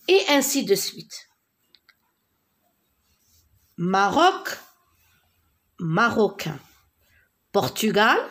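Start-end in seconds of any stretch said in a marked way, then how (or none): none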